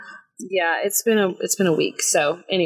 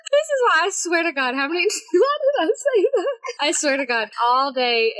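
laughing; 9 semitones higher than usual. Real, not acted. Flustered at own mistake.

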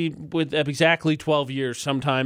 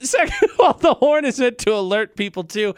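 laughing while protesting; 7 semitones higher than usual. Real, not acted. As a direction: talk through a laugh. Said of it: "laughing through rebuttal".

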